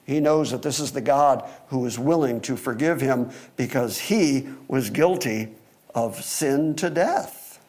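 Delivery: ordinary talk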